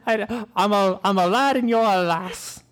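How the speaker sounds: high, put-on British accent; badly faked lad-and-lass British; horrible British accent. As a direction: play up a silly British accent. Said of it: "these horrible accents".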